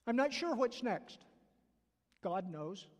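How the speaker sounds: normal talking voice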